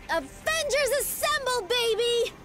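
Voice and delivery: very high, thrilled battle cry; squealing high with excitement; gleeful rallying cry. Acted. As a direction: belt out the catchphrase like an overjoyed fan. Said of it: "say it. You know you want to".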